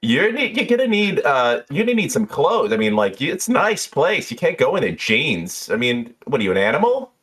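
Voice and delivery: lightly voiced, scolding bartender; a hint of a bossy bartender; Jeannie. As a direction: lecture the caller, pitch jumping high. Game master plays bartender.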